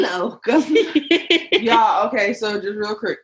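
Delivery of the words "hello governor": laughing mock British accent; playful pretend British accent. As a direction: imitate a British greeting through laughter. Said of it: "turns on her British accent".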